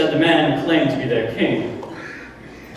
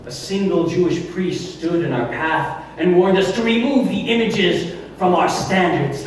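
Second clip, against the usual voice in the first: ordinary talk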